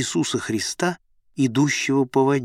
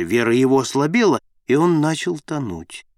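usual speaking voice

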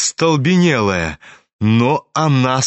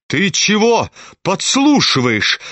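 sharp cry; 6 semitones higher than usual. A narrator's reading. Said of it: "крикнула она".

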